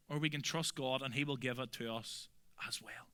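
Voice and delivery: hushed, breathy whisper of trust; quiet whispered reassurance to the congregation. Real, not acted. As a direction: drop to a whisper as if sharing a secret. Hushed aside during the sermon.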